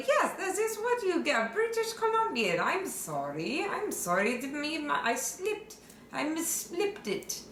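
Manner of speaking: mild embarrassed babble; flustered excuse. Acted. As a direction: backpedal nervously, voice up. Flustered apology after slip.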